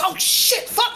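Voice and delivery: high-pitched startled cursing; panicked swearing. Acted. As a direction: curse as the caught little kobold. Captured kobold panics in story.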